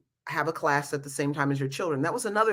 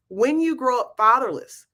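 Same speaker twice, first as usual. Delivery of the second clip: talking around a retainer; wearing a retainer; 6 semitones higher, 3 dB louder than usual. Real, not acted. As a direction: retainer in, slightly slurred. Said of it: "I got my retainers in tonight".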